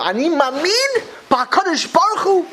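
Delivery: high-pitched, fervent declaration of faith, hammed up. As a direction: imitate an emotional believer proclaiming faith. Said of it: "they talk like that".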